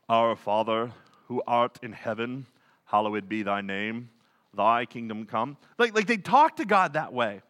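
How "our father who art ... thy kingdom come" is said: much lower, solemn churchy intonation; mock the holy-sounding prayer voice; pious prayer voice. Imitating people who pray in King James style. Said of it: "get all pious sounding and their voice kinda changes".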